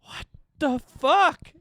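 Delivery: very high, slow, loud mock panic. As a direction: stretch it out like total disbelief. His own mock panic at ring.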